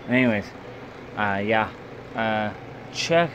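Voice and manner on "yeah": ordinary delivery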